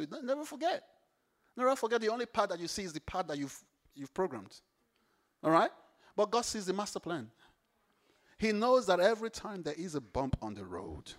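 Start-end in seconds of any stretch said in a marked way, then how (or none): none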